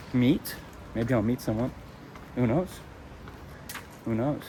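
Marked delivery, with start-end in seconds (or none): none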